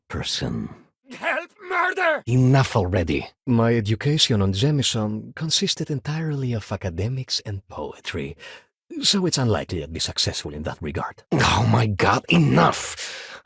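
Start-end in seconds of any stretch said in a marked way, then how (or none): 1.1-2.2 high panicked cry for help; shout for help. Acted. Shopkeeper screams to passersby, panicked shout. 11.3-13.4 exasperated outburst. Acted.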